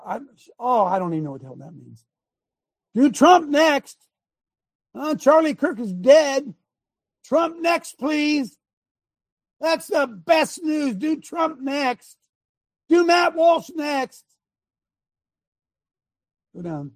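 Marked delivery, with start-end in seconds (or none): 2.9-14.2 high, slow, mock-gleeful yelling; hammed-up reading of a cruel post; mock gloating poster. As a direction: sneer it out like a gloating troll. Reading out gloating posts aloud.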